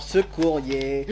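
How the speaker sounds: ordinary talk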